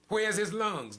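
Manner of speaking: a parent's desperate shout; anguished outcry. Acted. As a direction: wail the question at the top of the voice. Grieving parent asking in sermon.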